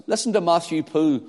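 ordinary talk

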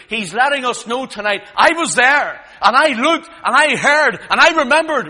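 high, loud, climbing testimony; impassioned preaching. Real, not acted. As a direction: punch out each phrase at full force. Preaches at full force.

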